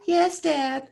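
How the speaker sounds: very high, squeaky pretend baby. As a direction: answer dad as the baby would. Answers dad as the baby.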